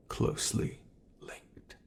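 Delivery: whispered, hushed and conspiratorial; secretive whisper. Acted. Monster confiding a secret to its captive, whispered. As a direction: let it drop to a secret whisper.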